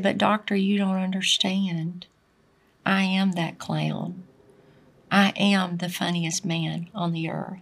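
quiet, heavy-hearted confession; weeping clown. Retold or imitated. The weeping clown answers the psychiatrist, soft and sad. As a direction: let it sink low and soft, a sad confession. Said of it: "from him weeping".

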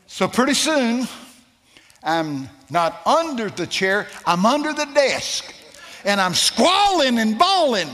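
loud, high climactic preaching; preaching at full force. Acted. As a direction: build the story to a roar.

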